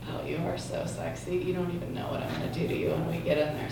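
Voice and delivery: soft, husky seductive purr; seductive woman. Pretend. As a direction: murmur it low as a temptress. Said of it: "my impression of a woman with really bad O C D".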